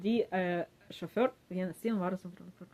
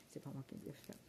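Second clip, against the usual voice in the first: whispered aside; 4 semitones lower, 17 dB quieter than usual. Retold or imitated. Whispered aside in retold quarrel.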